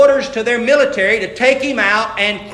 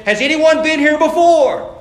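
raised, higher tour-guide call; 7 semitones higher, 5 dB louder than usual. Acted. Guide asks visitors on Mount Calvary, calling out. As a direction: throw the question out to the whole group.

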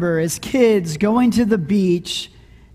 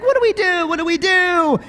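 high-pitched kid panic, played up; mock panicked yelling; 8 semitones higher, faster than usual. Dad imitating kids fleeing the wave, mock panicked yell. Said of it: "and they're yelling".

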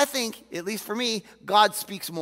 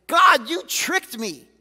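Jeremiah's cry; 7 semitones higher, 9 dB louder, slower than usual. Retold or imitated. Preacher voices Jeremiah's complaint.

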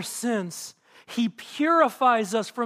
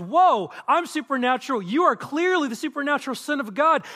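higher, louder, mildly alarmed exclamation; mock alarm; 6 semitones higher, 5 dB louder than usual. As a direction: act out a startled spirit, voice raised. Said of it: "And in fear".

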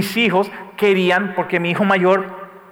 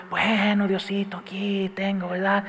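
softer, meek little grandmother voice; a light mock abuelita; imitating grandma; 7 dB quieter, slower than usual. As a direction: hold out the money shyly like an old granny. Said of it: "Estoy sacando como mi abuelita".

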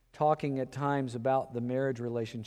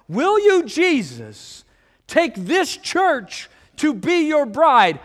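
wedding officiant; 12 semitones higher, 9 dB louder, slower than usual. Retold or imitated. Wedding officiant asks the vow.

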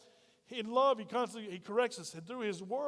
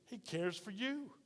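tender, soft; 6 dB quieter, slower than usual. Acted. Pastor softly stresses God's care.

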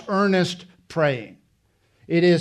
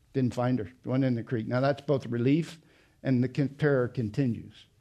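much softer, holding back tears; choked up; 3 semitones lower, 6 dB quieter than usual. Real, not acted. Father recalling searching the creek, choked and soft. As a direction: let the voice sink under the feeling. Emotional recollection, voice drops.

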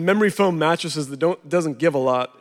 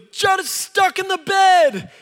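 put-on high, slow child's shout; high child voice pleading; urgent little boy; 14 semitones higher, 3 dB louder, slower than usual. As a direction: spell out the news like a frantic kid. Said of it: "he goes".

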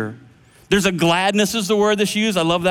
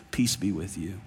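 soft, low blessing; tender hush of peace; 11 semitones lower, 10 dB quieter than usual. Acted. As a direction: say it gently, almost under the breath. Jesus's first words, hushed.

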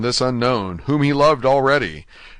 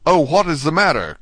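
higher, urgent cry of concern; 6 semitones higher, 4 dB louder than usual. A narrator's reading. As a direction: cry out the question in alarm. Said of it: "cried Dante".

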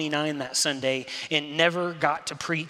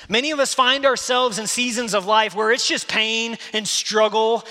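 loud, raised preacher's voice driving each point home; preaching at full force; 6 semitones higher, 7 dB louder than usual. Acted. Preacher urging the congregation to receive grace, loud and driving. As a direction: preach it out, pushing hard at the congregation.

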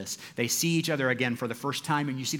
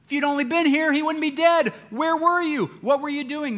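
high, loud, pleading cry of grief; anguished outburst; 12 semitones higher, 7 dB louder than usual. Acted. Martha crying out at Jesus over her brother, anguished and loud. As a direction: confront him with the loss, voice straining high. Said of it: "Martha says".

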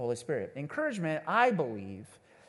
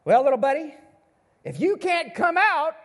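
jolly grandpa; 11 semitones higher than usual. Retold or imitated. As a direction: tease it like a cheerful grandpa. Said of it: "he goes".

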